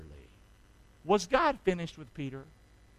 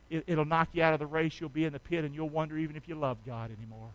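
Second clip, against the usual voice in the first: ordinary talk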